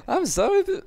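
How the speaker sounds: put-on very high, loud German accent; terrible German accent. Gamer apologising to viewers, still in German accent. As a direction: apologise in a squeaky mock-German voice. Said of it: "It's a terrible German accent".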